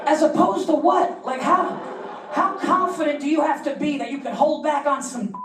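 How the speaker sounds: can you believe this guy; stunned rhetorical question; incredulous. Acted. Incredulous comic reaction.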